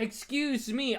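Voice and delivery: usual speaking voice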